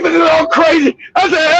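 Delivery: normal talking voice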